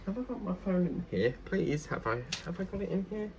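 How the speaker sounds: high, soft muttering to yourself; muttering to self. Real, not acted. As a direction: mutter while searching. Searching for phone, muttering.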